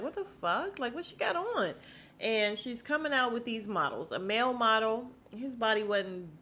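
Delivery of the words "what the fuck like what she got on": high, shocked mock disbelief. Greg reacting to Nene's see-through outfit, high mock shock. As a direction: play Greg as stunned by the outfit, pitched high. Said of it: "Greg even looked like".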